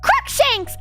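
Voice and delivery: high-pitched mock battle cry; squealed spell, hammed up; shouted. A narrator's reading. Heroine fires back at Voldemort, high shout. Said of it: "I shouted at him".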